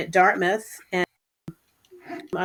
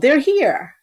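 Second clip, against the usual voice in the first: raised, higher-pitched imitation of a yell; mild retold yell; 6 semitones higher, 4 dB louder than usual. People yelling news of the team's arrival, mild yell. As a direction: call it out as a lightly acted announcement. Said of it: "people yelled".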